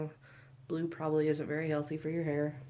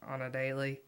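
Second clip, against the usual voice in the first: ordinary talk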